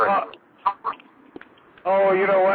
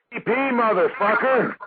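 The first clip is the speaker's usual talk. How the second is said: Samuel L. Jackson; 7 semitones higher than usual. Retold or imitated. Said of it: "Is that Samuel L. Jackson".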